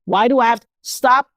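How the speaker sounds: ordinary talk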